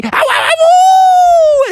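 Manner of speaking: loud, very high drawn-out howl; escaping wolf's howl. Acted. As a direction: howl triumphantly as you run off. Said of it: "as he ran away".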